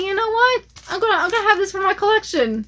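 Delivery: excited retold thought.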